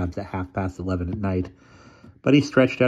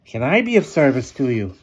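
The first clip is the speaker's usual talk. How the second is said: hunchback assistant; 6 semitones higher, 5 dB louder than usual. A narrator's reading. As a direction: speak like a sly old hunchback.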